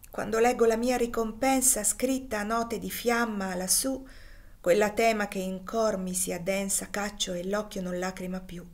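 plain reading voice

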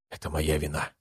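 soft, half-whispered admission of guilt; hushed whisper. A narrator's reading. Pronin blames himself to his companion, hushed half-whisper. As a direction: say it softly, almost under the breath. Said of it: "прошептал Пронин".

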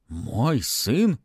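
mummy questioning in disbelief; surprised. A narrator's reading. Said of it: "удивлённо спросила мумия".

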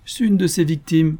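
high-pitched comic punchline; comic intonation. A narrator's reading. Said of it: "avec une intonation comique".

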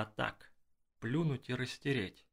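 ordinary delivery, nothing marked

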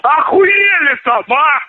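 real high-pitched, drawn-out angry shout; shouted angry challenge; indignant yelling. Real, not acted. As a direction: yell it in outrage, stretching the words. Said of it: "Слышь, ты не ори".

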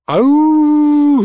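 протяжный высокий вой зверя; завой высоко и протяжно; звериный вой. A narrator's reading. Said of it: "знакомо завыло совсем близко". (высоким протяжным воем)

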